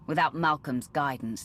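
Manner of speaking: normal talking voice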